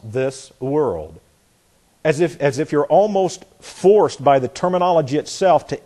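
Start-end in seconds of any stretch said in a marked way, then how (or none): 0.0-1.2 "this", brief pause, then "world". Shown as a demonstration. Preacher repeating what Josh said, pausing between "this" and "world". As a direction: separate "this" from "world".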